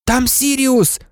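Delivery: high, urgent exclamation. A narrator's reading. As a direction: call out a sudden sighting. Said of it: "вигукнув Гаррі". (схвильований вигук)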